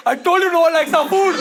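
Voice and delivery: comic indignant outburst; mock exasperated complaint. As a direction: rattle it off high as an aggrieved employee. Acts out employee complaining to boss.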